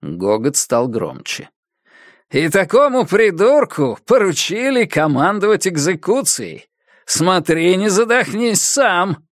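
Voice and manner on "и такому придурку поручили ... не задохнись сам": high, jeering taunt; mocking taunt. A narrator's reading. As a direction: sneer and provoke him. Sam taunts the colonel.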